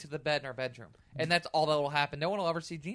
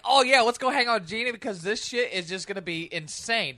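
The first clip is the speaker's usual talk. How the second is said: mimicking Gina; 5 semitones higher, 4 dB louder than usual. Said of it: "everything out of her mouth is awesome".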